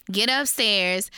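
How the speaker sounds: usual speaking voice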